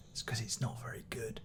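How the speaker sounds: hushed snide jab; whispered aside. Acted. Reviewer whispering a dig at the obscure record, hushed. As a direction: whisper the insult behind a hand.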